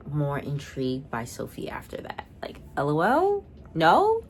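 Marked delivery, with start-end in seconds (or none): 2.8-4.2 high rising disbelief; incredulous no. Retold or imitated. Reacting to Margot's scheme against Sophie, high incredulous no. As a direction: go up high, can't believe it.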